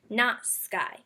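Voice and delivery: usual speaking voice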